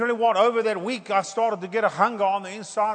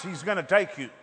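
usual speaking voice

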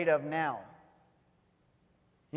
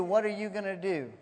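usual speaking voice

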